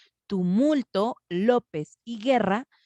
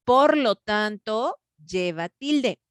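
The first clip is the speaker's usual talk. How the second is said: "Por lo tanto, lleva tilde" said slowly, louder on "Por"; slow emphasis; 2 semitones higher, 3 dB louder, much slower than usual. Shown as a demonstration. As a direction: spell out the conclusion slowly. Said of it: "teacher stresses conclusion".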